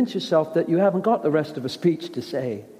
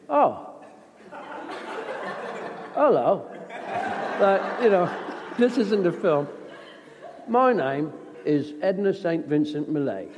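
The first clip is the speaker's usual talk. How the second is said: mock Caine greeting. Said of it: "he'd go".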